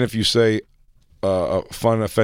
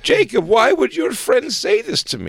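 high-pitched wailing mom impression; hammed-up distress in a very high voice; put-on mother, aghast and pleading; mock horrified mother; slower than usual. Mimicking Jacob's horrified mother.

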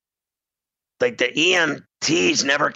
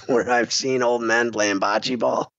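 normal talking voice

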